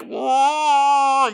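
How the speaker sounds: long, high open wail; mock wail. Shown as a demonstration. Showing what the cave sound was not, high wail. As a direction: wail out one held note. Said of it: "what's known as a suffocated wail".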